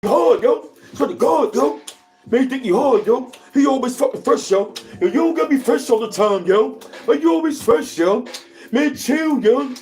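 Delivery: high, heated mock rant; imitating an angry hater. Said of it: "B F ninety-five sounded just like him".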